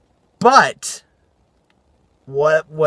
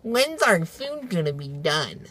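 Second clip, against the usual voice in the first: slow, soft put-on teen voice; exaggerated slow imitation of impatient girls; 9 dB quieter, slower than usual. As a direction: mimic a teenager drawling a demand. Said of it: "That's how they sounded".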